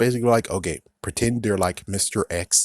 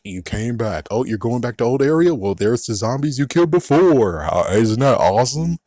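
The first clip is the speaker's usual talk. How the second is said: mocking game voice; 5 dB louder than usual. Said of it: "Dead Island is like".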